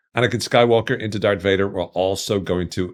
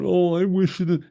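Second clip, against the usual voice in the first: high-pitched mock lament; mock whining; 12 semitones higher than usual. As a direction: imitate a viewer moaning in regret. Mocking an imagined viewer's complaint.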